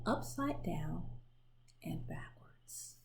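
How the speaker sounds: slow, fading to a whisper on backwards; hushed refrain. A narrator's reading. Narrator hushing the refrain to close the page. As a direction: let the refrain sink to a whisper.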